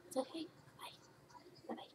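soft whispered goodbye. Real, not acted. Owner whispering goodbye to her ferrets. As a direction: breathe it without voice.

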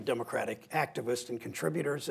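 ordinary delivery

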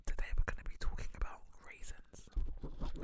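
slow, soft whisper, playing at ASMR; mock ASMR whisper. Podcast guest does ASMR for those who like it, whispering. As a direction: whisper it slowly like a spoof ASMR video. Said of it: "A S M R for those".

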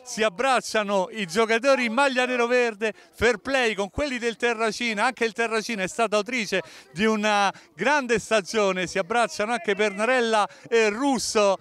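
high, jubilant full-time call; excited final whistle call. Real, not acted. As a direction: celebrate the win on air.

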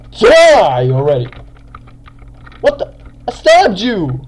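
loud, exasperated attack cry; aggressive gamer yelling. Real, not acted. Gamer attacking an opponent who won't die, aggressive yelling. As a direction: yell in frustration at a target that won't go down. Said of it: "sometimes a bit aggressive in games".

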